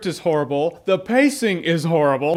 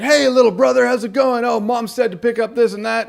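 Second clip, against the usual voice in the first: cheesy movie brother; 4 semitones higher than usual. Retold or imitated. Mocking the brother's cheesy intro.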